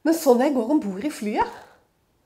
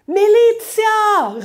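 higher, louder, stretched-out call; shouting for police; 10 semitones higher, 8 dB louder, much slower than usual. Retold or imitated. Customs officer shouting for the police, long loud call. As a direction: bellow it across the hall, very slow.

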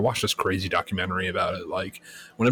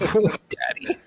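mock breathy voice; 12 semitones higher than usual. Joking after breathing heavy.

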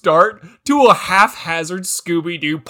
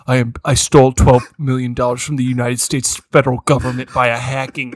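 a lower, matter-of-fact Marty; mock deadpan confession; 10 semitones lower, 3 dB louder than usual. Friend voicing Marty confessing his hacking, deadpan. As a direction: drop the voice and deadpan the crime.

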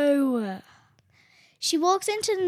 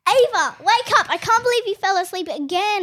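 high, loud exasperated call; scolding a friend who dozed off twice; loud wake-up call; 5 semitones higher, 7 dB louder than usual. Acted. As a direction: shout her awake, fed up. Waking friend again.

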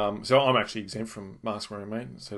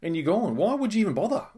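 incredulous mock; 9 semitones higher than usual. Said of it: "I'm like".

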